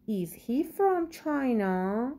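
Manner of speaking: very slow question rising on from; slow model reading. Shown as a demonstration. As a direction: read the question word by word for learners. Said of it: "teacher reads question slowly". (خیلی آهسته و شمرده بپرس)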